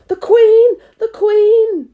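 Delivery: high, loud cry of alarm; called out. A narrator's reading. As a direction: shout a panicked warning across the garden. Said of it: "called out".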